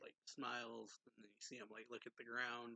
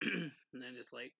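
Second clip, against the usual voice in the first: usual speaking voice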